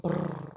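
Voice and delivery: low soft lip-buzz brrr like the horse; imitated horse sound. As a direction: blow a quiet brrr through the lips. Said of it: "horse over there makes that sound".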